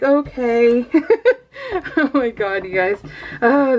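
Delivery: usual speaking voice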